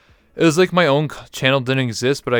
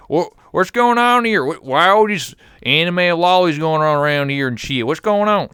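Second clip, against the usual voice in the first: put-on antagonizing hillbilly accent; 7 semitones higher, 5 dB louder than usual. Streamer showing how his hillbilly character picked on anime fans, high loud hillbilly accent. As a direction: sneer at the anime crowd like a rowdy American hillbilly. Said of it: "I would just be like that".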